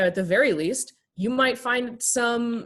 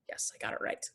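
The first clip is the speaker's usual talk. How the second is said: soft, voiceless aside to herself; whispered aside; 13 dB quieter, faster than usual. Real, not acted. A speaker quietly congratulates herself on a name, whispering. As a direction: whisper it under your breath. Aside after naming Michael.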